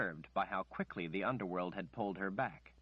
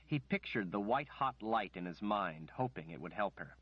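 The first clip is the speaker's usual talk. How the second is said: usual speaking voice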